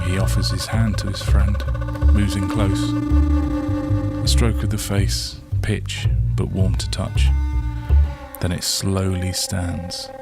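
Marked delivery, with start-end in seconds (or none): none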